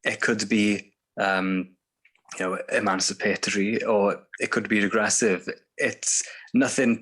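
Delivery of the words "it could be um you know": normal talking voice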